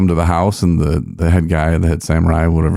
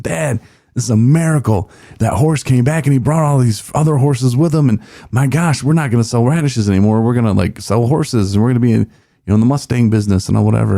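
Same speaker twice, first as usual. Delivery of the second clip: higher, fast, gushing excitement; a son bursting with joy over the horses; excited son; 6 semitones higher, faster than usual. Retold or imitated. Son tells his dad the runaway horse came back with more, excited higher voice.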